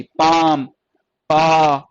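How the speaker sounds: teacher repeating the long vowel. Shown as a demonstration. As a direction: draw out the आ. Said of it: "long जा रही थी".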